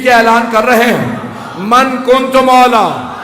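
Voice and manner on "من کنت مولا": slow, loud, drawn-out proclamation; proclaiming. Acted. Prophet's declaration at Ghadir, proclaimed slowly and loud. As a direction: call it out like a herald to thousands.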